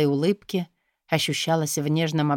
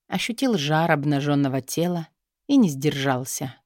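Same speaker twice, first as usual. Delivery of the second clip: ordinary delivery, nothing marked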